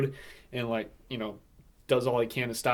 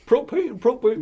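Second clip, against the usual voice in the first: high, loud mock Texan; Hank Hill; 10 semitones higher, 7 dB louder than usual. Riffing on the truck driver, Hank Hill impression. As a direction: punch it out in a cartoon Texan voice. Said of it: "Hank Hill is the truck driver".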